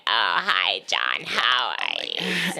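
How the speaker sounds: mock her own closed, unresonant way of talking; dropped low and held back in the throat; tight everyday voice. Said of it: "Not like me where I'm like".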